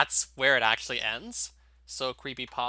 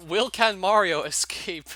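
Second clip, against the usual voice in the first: laughing; 6 semitones higher than usual. Real, not acted. Gamer reading a hack's broken English to viewers, laughing. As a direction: crack up while reading the text aloud. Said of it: "I'm sorry for laughing so much".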